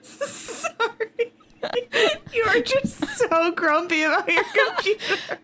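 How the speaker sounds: laughing to tears. Real, not acted. Laughing fit, apologizing.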